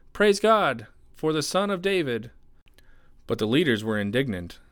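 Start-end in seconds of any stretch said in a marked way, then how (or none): 0.1-2.3 high, loud cry of praise; children shouting. A narrator's reading. Children in the temple praise Jesus, shouting. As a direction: call out joyfully in a raised voice. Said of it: "the children in the temple shouting".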